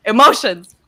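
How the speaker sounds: sudden loud outburst of feeling; emotional scream. Shown as a demonstration. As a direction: blurt it out as a mini scream. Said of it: "I scream whenever I feel an emotion".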